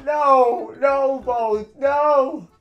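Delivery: high, loud disbelieving shouts; shocked yelling. Retold or imitated. Player reacting to his favorite villager moving, shocked yelling. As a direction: scream the questions in pure disbelief, drawing out the mean.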